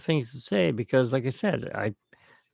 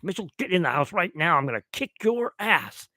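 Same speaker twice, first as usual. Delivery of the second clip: higher, fast imitating an angry dad; 5 semitones higher than usual. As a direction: threaten like a mock-angry father. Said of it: "He goes".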